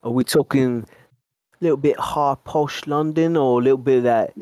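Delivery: mock British geezer accent. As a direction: play up a posh-to-swaggy London accent. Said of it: "What does your British accent sound like".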